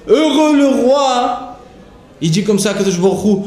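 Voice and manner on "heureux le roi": slow, high, drawn-out proclamation; fervent recitation. Acted. Teacher voicing God's praise of the king, slow and high. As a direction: lift the words up like a blessing.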